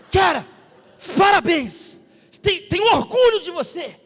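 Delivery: loud, high-pitched praise; exuberant congratulations. Retold or imitated. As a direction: shout how proud you are, retelling it. Congratulates pilot in retold scene.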